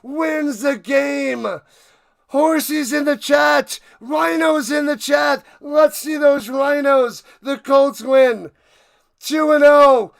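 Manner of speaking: loud, high, drawn-out game-winning call; ecstatic play-by-play. Real, not acted. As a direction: shout the walk-off victory to the fans. Live play-by-play celebrating winning kick.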